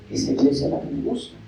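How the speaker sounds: susurro burlón. Retold or imitated. Feligresa que elige iglesia cómoda.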